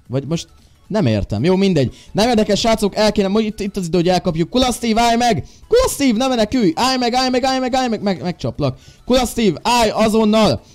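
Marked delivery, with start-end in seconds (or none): 4.5-8.0 high, loud calls to stop him; high, loud yelling for Kula Steve to stop; calling out. Real, not acted. Calling after fleeing villager. 9.1-10.6 high, loud order to halt; stern, high, loud call to halt; stern command. Real, not acted. Ordering villager to stop.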